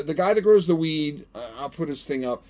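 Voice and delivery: usual speaking voice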